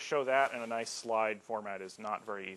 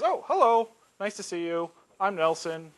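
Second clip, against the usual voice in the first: high, loud "oh", then easing; mock-friendly server waking up to greet you; cheery greeting; 6 semitones higher, 7 dB louder than usual. Mail server greets as person.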